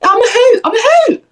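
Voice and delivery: very high, playful boast; playful high squeal. Pretend. Host joking about being funny, in a high silly voice. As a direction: pitch it right up, silly and pleased.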